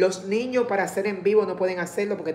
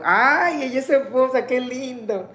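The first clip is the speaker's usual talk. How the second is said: delighted gush; 5 semitones higher, 4 dB louder than usual. Real, not acted. Learns Delmira is Tiago's wife.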